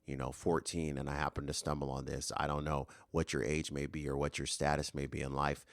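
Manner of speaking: usual speaking voice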